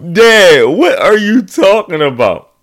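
high, loud baffled question; stunned at what dad means; baffled son. Retold or imitated.

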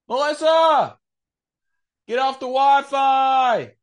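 loud high yell across the house; shout. Real, not acted. Shouting to his wife in another room, loud and high. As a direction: yell for Melissa to get off the Wi-Fi.

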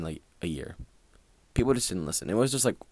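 ordinary talk

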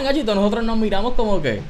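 ordinary talk

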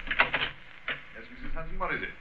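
brisk doorway chat from across the room; off-mic exchange. Acted. Holmes answers housekeeper at the door.

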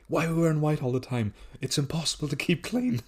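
high, loud imitation of a meltdown; hammed-up exasperated complaint; mock going mental. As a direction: act out a character losing it, pitched right up. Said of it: "he's, like, going mental".

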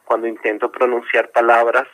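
ordinary talk

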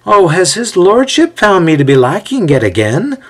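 high-pitched mock deference; sarcastic retort. A narrator's reading. Winded Othello to restless Ezio, sarcastic retort. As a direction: retort with exaggerated wounded politeness. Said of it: "Othello retorts".

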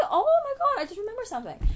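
high, loud burst of realization; sudden excitement. Real, not acted. Vlogger suddenly remembering mom's birthday, loud excited burst. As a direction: exclaim it as it suddenly hits you. Sudden recollection mid-story.

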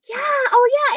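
high, bright sudden realization; light up with understanding. Real, not acted.

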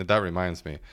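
ordinary talk, nothing marked

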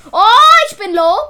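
startled loud shout, then urgent; alarmed yell. Real, not acted. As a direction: shout it out in sudden panic. Low health in a fight.

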